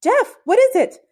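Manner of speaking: high alarmed shout. A narrator's reading. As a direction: yell down in alarm. Shouting down to hurt friend.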